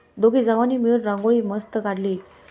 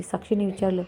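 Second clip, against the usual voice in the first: ordinary delivery, nothing marked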